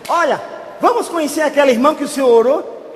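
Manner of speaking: high, loud, eager invitation; beaming excitement. Retold or imitated. Nursery owner invites the pastor to meet the sister, beaming excitement. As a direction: imitate her bursting with good news. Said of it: "com um sorriso até aqui".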